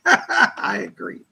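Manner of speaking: slow, laughing reread of "I agree". Real, not acted. Laughing as she rereads the viewer's "I agree". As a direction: chuckle through "I agree", drawn out.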